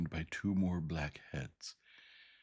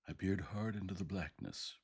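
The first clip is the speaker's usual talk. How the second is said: usual speaking voice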